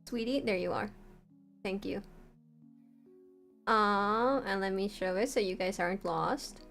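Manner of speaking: ordinary talk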